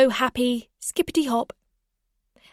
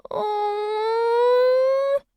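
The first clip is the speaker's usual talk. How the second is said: long, high, rising whine; child's whine; 12 semitones higher than usual. A narrator's reading. Little girl losing the rabbit, long high whine. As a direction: draw out a childish wail of protest. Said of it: "she continued to whine".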